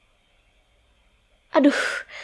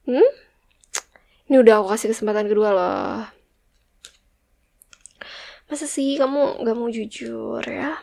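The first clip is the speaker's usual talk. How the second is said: usual speaking voice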